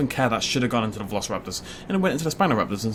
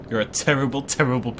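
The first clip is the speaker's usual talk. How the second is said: normal talking voice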